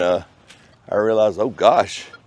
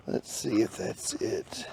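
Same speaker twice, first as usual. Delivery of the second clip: whispered; 15 dB quieter than usual. Real, not acted. Digging, speaks under breath.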